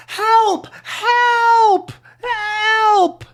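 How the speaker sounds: high, loud cries for help; a lady shrieking help in fear; yelling for help. Acted. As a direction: scream for help as the frightened lady. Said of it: "This lady is yelling".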